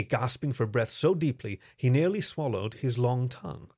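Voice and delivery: usual speaking voice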